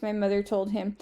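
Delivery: ordinary delivery, nothing marked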